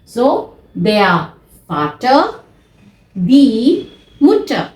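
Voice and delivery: slow Vater and Mutter, final r silent; German pronunciation. Shown as a demonstration. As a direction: model der Vater, die Mutter with dropped r. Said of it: "र जो है वो silent रहेगा".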